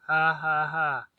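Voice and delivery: hearty laugh at own joke. Real, not acted.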